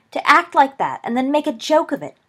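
high, loud accusation; indignant outburst. A narrator's reading. Lily accusing Remus of mocking her, angry outburst. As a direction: lash out, hurt and indignant. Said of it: "she burst out".

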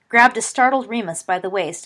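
ordinary delivery, nothing marked

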